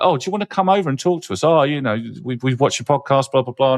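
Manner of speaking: gushing vendors. Retold or imitated. Host mimics vendors fawning over him at the conference, high and fast. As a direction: coo like a salesperson who spotted a celebrity. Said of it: "everyone's like".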